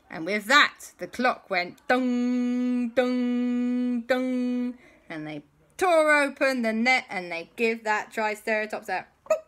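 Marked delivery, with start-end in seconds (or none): 1.0-4.7 slow, ringing dung dung dung; clock chiming. Acted. The magic clock sounding, slow booming chimes.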